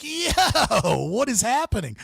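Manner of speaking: half-laughing, can't believe what he's seeing; laughing disbelief. Retold or imitated. His own retold baffled reaction.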